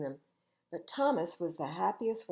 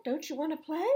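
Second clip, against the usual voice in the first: put-on higher, coaxing child voice; childlike invitation; 10 semitones higher, faster than usual. A narrator's reading. Daniel coaxing shy Chrissy to join, childlike. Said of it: "called Daniel".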